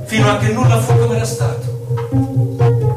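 usual speaking voice

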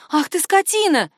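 high, indignant exclamation; outraged. A narrator's reading. Woman cursing her assistant over visa blackmail, outraged. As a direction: burst out at him in outrage.

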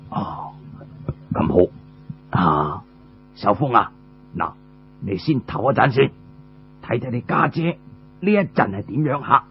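a lightly voiced old father. Father calms his son with a low old man's voice.

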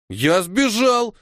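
put-on high, slow, insistent boy; displeased exclamation. A narrator's reading. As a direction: protest high, stretching the words out. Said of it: "недовольно воскликнул Толя".

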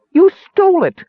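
very high, loud squawk; shrieked accusation. A narrator's reading. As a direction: shriek it like an enraged tiny creature. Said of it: "she shrieked".

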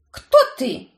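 high, loud startled question; frightened. A narrator's reading. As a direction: blurt it out in fright. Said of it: "испугалась она".

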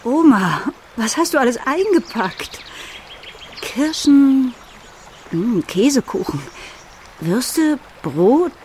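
leicht jüngere, höhere Stimme, neugierig und staunend; junge Enkelin. Acted. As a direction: als junges Mädchen die Oma fragen. Erwachsene Erzählerin spielt junge Enkelin. (neugierige Enkelin, etwas höher gesprochen)

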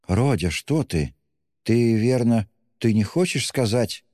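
mother beginning in fright; understated acted fright, pitch climbing; frightened. A narrator's reading. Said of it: "начала была в испуге Пульхерия".

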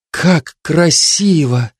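breathless, slow wonder; delighted gasp. Acted. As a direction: breathe it out in awe. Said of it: "восторженно выдохнул Барт".

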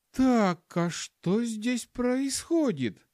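high, slow female character; woman's voice. A narrator's reading. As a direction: demand an explanation in a high, drawn-out voice. Woman runs up demanding explanation.

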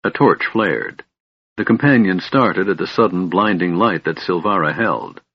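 usual speaking voice

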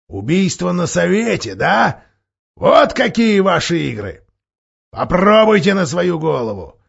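high, loud, slowing defiance; defiant anger. Acted. Dwarf defying Caspian, angry shout. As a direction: throw down the challenge. Dwarf defies drawn sword.

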